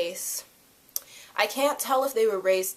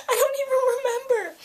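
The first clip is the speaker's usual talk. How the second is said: whiny. Real, not acted. Can't recall actor's name.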